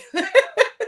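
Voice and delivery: high, loud cackling laugh; burst of laughter. Real, not acted. Coach amused by her own "badassery", loud high laugh.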